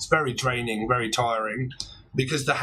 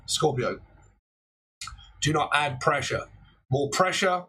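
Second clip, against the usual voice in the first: ordinary delivery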